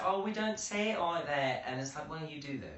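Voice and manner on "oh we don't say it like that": higher, slow mock of the flatmate; lightly mimicking an Australian flatmate; quoting the Australian flatmate in a mock accent; imitating flatmate. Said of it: "they always say".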